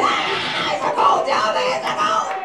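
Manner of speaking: breathless, high mock panic; mock scream. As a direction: squeal it like a scared man. Men screaming about ghost.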